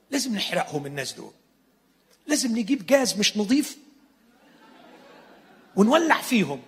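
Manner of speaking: hammed-up call to burn them; mock fury. Disciples call for burning.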